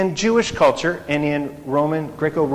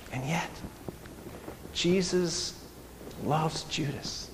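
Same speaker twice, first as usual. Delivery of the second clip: hushed, tender; 13 dB quieter, slower than usual. Shown as a demonstration. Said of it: "That's seen in different parts".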